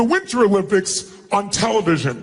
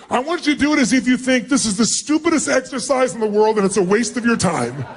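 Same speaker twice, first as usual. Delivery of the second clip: ordinary talk, nothing marked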